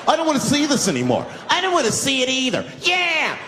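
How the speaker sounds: high indignant chorus of objectors; suddenly brave passengers. Acted. Dummies on the bus joining in once someone spoke, high bold protest. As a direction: pipe up high, building to a cheer. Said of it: "now they're brave".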